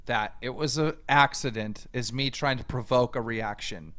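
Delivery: ordinary talk, nothing marked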